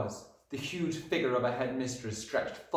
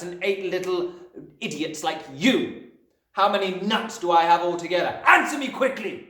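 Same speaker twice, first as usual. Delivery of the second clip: raised, hectoring lecture with furious bursts; exasperated Trunchbull; 7 semitones higher, 6 dB louder than usual. A narrator's reading. Headmistress giving Wilfred one more chance, loud and angry. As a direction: drum the sum into him, building to a shouted demand. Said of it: "shouted the Trunchbull".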